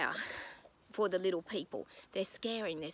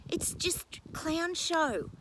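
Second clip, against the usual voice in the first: scornful; 9 semitones higher, 3 dB louder, much slower than usual. Real, not acted. Mocking rant.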